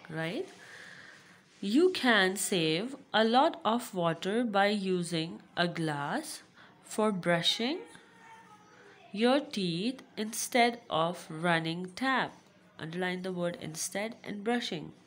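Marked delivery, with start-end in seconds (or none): 1.6-12.3 loud, slow classroom reading; read-aloud lesson. Acted. Teacher reading about wasting water to pupils, read-aloud. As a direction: project each phrase clearly for the class.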